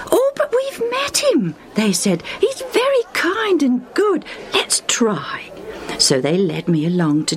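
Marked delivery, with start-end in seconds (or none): 0.1-4.3 friends' voices. Retold or imitated. Friends voiced in Lucius's story. 4.5-5.5 whisper. Retold or imitated. Friend whispers encouragement.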